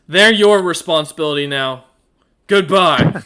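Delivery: he hands the group off and storms away; loud dismissal. Acted. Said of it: "And he points to Tilcat and Rander and says".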